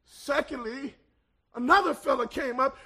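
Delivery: ordinary delivery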